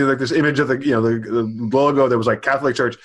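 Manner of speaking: ordinary delivery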